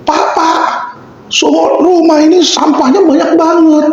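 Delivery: high-pitched mock yell of a complaining maid. Maid calling her boss about the trash, mock high yell. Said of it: "terus dia teriak-teriak".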